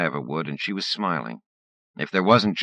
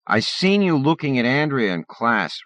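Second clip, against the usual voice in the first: taunting bully; 9 semitones higher, 5 dB louder than usual. Acted.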